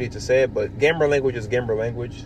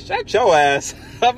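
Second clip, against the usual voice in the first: laughing retort; 8 semitones higher, 3 dB louder, slower than usual. Retold or imitated. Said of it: "I said".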